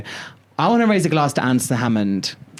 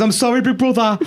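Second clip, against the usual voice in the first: imitating Alison's Brummy apology; half-joking Brummy accent; 8 semitones higher than usual. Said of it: "I'm trying to do Brummy accent".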